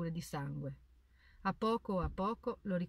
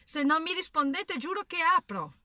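much higher, loud shout of a threat; desperate threat; 10 semitones higher, 8 dB louder than usual. A narrator's reading. Boy shouts through the bedroom door that he will open it, high desperate yell. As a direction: yell it at the closed door, half panicked.

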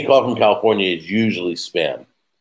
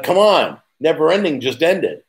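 exasperated complaint; 8 semitones higher than usual. Retold or imitated. Friends waiting for pasta, mock exasperated complaint.

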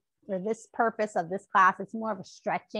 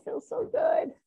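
delighted squeal; 14 semitones higher, slower than usual. Real, not acted. Stretch feels good, squeal.

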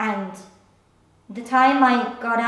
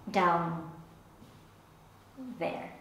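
lowered, softened voice; hushed, reluctant euphemism; discreet euphemism; 6 semitones lower, 11 dB quieter than usual. Acted. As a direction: drop low and quiet on the delicate words. Euphemism for her private parts.

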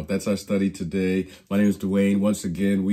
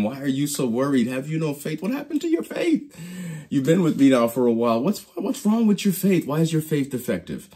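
higher-pitched, mildly exasperated questions; incredulous questioning; 5 semitones higher than usual. Retold or imitated. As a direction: question them in mock disbelief. Jesus questioning worried disciples, acted.

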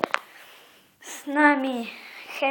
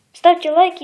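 loud; 2 semitones higher, 8 dB louder than usual. Shown as a demonstration.

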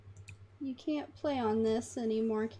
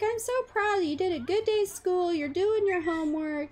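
high, bright-voiced proud mom; 6 semitones higher, 3 dB louder than usual. Mom praising her son for homework, high and gushing. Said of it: "She's like".

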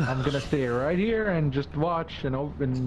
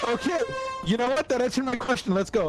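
flustered; 9 semitones higher than usual. Real, not acted. Escaping the quicksand.

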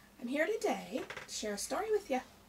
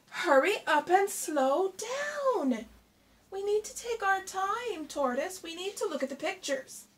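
Hare cries out; 8 semitones higher, 6 dB louder than usual. Acted. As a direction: stretch each word out loud, pleading. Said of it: "when Hare cries".

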